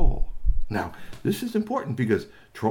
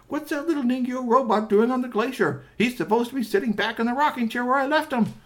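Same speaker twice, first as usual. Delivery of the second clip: high, exasperated disbelief; indignant surprise; 14 semitones higher than usual. Acted. Doctor O'Toole Talltale spotting her robot on the glacier, indignant. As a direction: protest in a high, astonished voice.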